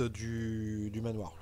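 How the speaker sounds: ordinary talk, nothing marked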